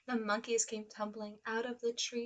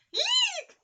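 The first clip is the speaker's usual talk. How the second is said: very high frightened eek shriek; elephant shrieks eek; 9 dB louder than usual. Acted. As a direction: squeal in terror. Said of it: "shouted the elephant".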